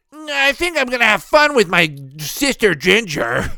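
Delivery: put-on high, loud, slow goofy kid voice; cartoon brother voice. Vlogger voicing Ginger's brother, high and slow. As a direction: drag it out like a dopey little brother.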